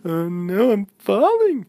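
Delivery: high, loud panicked falling cry; scared cry. Acted. Girl falling, crying out in high panic. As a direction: yelp as she falls through the air.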